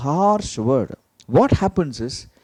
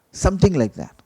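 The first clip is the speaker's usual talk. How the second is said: usual speaking voice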